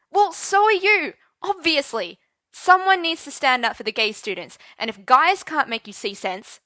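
loud heated retort. Acted. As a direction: argue back hard. Teacher argues back heatedly.

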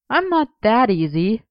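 playful, lilting refusal; teasing. A narrator's reading. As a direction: tease him lightly, pitch lifted. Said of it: "he teased".

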